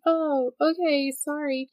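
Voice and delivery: high, slow sheepish voice; lightly voiced meek apology; apologetic intruder. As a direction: imitate a confused stranger backing off.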